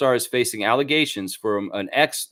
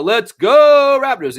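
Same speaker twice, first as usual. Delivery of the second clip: loud, high drawn-out fan cheer; rallying cheer; 13 semitones higher, 12 dB louder, slower than usual. Real, not acted. Host signing off before Raptors versus Celtics, rallying cheer. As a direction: cheer the team on, stretching the go.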